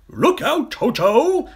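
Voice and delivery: high, loud warning shout; urgent shout. A narrator's reading. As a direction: yell to save the children. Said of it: "shouted Humpy Rumpy".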